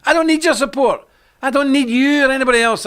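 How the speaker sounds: shouting down the critics; defiant loud retort. Real, not acted. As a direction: throw it back at them, loud and high.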